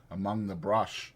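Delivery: usual speaking voice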